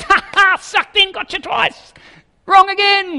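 loud, very high gloating taunt; mocking. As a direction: jeer like a hammed-up prankster who got them. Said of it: "God starts laughing at them, mocking them".

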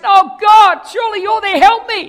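loud, high desperate plea; desperate cry. Acted. Man clinging to a branch begs God, desperate shout. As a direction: beg at the top of your voice. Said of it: "then he calls out in desperation".

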